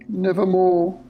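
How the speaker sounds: high, drawn-out eerie wail; Poe. A narrator's reading. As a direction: wail one lingering word in a high voice. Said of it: "The voice of Poe drifted back into silence".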